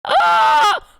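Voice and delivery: sudden high yelp; high shriek. Real, not acted. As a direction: shriek in surprise. Startled shout mid drawing.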